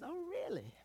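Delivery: a high, surprised "really?" from a doubtful father; surprised dad. Retold or imitated. Father on the phone hearing his son's news, high and surprised. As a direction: sound amazed and a little skeptical. Father hears dog can talk.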